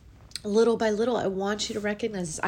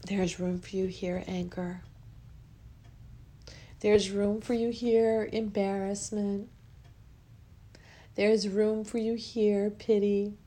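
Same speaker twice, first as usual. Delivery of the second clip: guided affirmation; 4 dB quieter than usual. Acted. Host guiding listeners to welcome each feeling, soft affirmation. Leads a guided emotional release.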